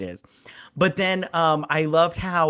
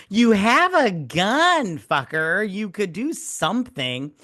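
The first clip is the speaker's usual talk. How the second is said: exasperated yell; 5 semitones higher, slower than usual. Retold or imitated. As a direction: yell at the useless character. Yelling at movie character.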